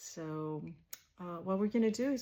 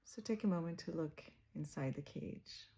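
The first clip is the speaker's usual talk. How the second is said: softer, unhurried meditation voice with long pauses; guided meditation; 4 dB quieter than usual. Real, not acted. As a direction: guide listeners gently inward, leaving space after each phrase.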